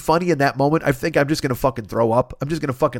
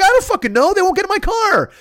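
much higher, louder helpless yell; high loud exasperated cry; frantic shout; 5 dB louder than usual. Retold or imitated. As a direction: yell back in panic. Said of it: "And I'm like".

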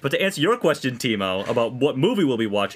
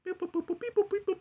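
high, soft computer beeps; robot beeps; 8 dB quieter, faster than usual. Pretend. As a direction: squeak it out like a little machine. Imitating a computer calculating.

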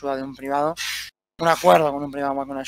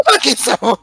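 laughing; 6 semitones higher, 5 dB louder than usual. Real, not acted. Laughing at friend's voice.

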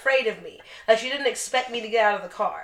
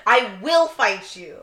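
mock challenge; 3 semitones higher, 7 dB louder, slower than usual. Challenging the driver on air.

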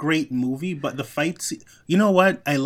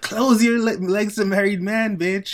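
high, loud mock quarrel; Nene Leakes; 7 semitones higher, 4 dB louder than usual. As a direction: hurl the insult in playful imitation. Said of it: "Nene fighting with, uh, Kim Zolciak".

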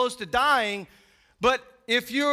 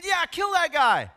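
higher, half-raised cheer for blood; mock crowd yell; 5 semitones higher, 3 dB louder than usual. Preacher as Paul at Stephen's stoning, mild mock yell. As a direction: egg the mob on without fully shouting.

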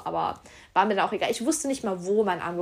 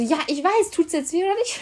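high, loud imitation of an impatient doctor; retold pestering question; mock impatience; 8 semitones higher, 6 dB louder than usual. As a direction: insist on an answer, exasperated. Retold doctor insists on an answer.

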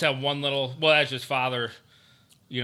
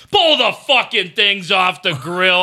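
loud, high, slow holler; retold dad's yell; 5 semitones higher, 9 dB louder, slower than usual. Dad shouting at son about the burning steaks, yelled. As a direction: imitate an irate dad yelling orders. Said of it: "yelling back".

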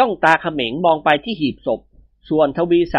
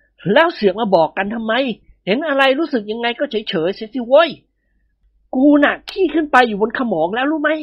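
higher, irritated scolding through the teeth; angry snap; 7 semitones higher than usual. Acted. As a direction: shut your pal up, fed up and tense. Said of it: "ขบกรามกรอด ทำตาเขียว". (ดุเพื่อนอย่างหงุดหงิด)

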